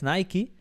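"Nike" as "NAI-kee", rising; two-syllable English "Nike", "nai-kee"; English pronunciation. Shown as a demonstration. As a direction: say "Nike" ending in ee. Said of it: "como se dice en inglés".

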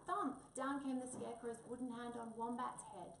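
ordinary delivery, nothing marked

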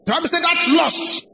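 preacher pushing his voice to the top; forceful preaching. Real, not acted. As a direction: proclaim it with all your strength. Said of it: "with all the strength I have".

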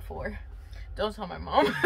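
ordinary delivery

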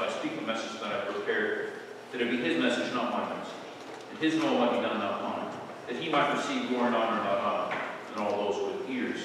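soft, quick murmured prayer; quiet prayer. Acted. Pastor praying over his sermon, soft hushed prayer. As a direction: pray softly for the sermon. Prays before preaching.

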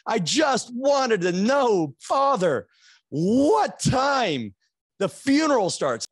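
high and loud, building to a shout on what time; exasperated outburst. Retold or imitated. Finally snapping at the priest about the funeral time, exasperated shout. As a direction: lose patience and yell the question.

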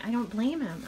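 ordinary talk, nothing marked

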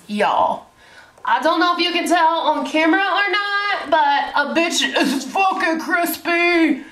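high, laughing, a bit slow; laughing disbelief. Real, not acted. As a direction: crack up showing off the burn. Showing her sunburn to camera.

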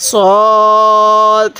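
সদ with its vowel drawn out; elongated সদ in chanted recitation; four-alif elongation. Shown as a demonstration. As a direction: hold সদ long, as in Quran recitation. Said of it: "সদের মধ্যেও চার আলিফ".

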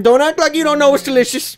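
high, sing-song goading; teasing put-on voice. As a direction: push it up high and loud, teasingly.

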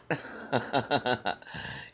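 short laugh breaking the act; real chuckle at the joke; laughing at the silly line; amused laugh. Real, not acted. Laughs at own bit.

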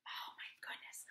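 hushed whisper. Real, not acted. Reader asks the child softly.

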